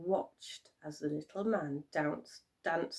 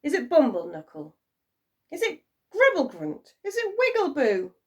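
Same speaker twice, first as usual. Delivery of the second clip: high, loud hopeful guesses; excited guessing; eager guessing; 8 semitones higher, 8 dB louder, faster than usual. A narrator's reading. Couple guessing names.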